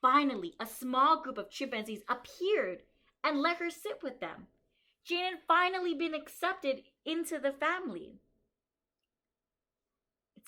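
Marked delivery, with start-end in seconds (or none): 0.0-8.2 bright, lively storybook reading for a class; reading to children. Acted. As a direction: read it out to the kids like a story. Reads picture book aloud.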